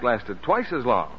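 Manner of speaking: normal talking voice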